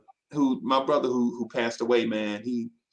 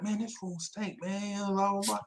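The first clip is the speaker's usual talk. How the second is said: quiet mock complaint; 6 semitones higher, 9 dB quieter than usual. Dudes quoted complaining.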